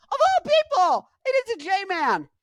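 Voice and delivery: squealed, very high falsetto disbelief; mock outrage. Host voicing J-Man's complaint, squealing in mock outrage. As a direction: shoot way up into hammed-up indignation.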